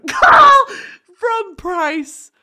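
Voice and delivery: very high, loud, breaking into a laugh. Real, not acted. Storyteller retries the line and fails, laughing. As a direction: squeal through real laughter.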